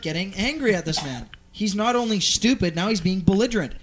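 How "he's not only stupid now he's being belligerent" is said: ordinary delivery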